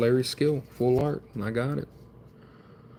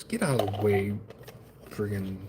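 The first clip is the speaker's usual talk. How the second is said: usual speaking voice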